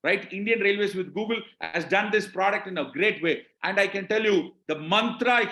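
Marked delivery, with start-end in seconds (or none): none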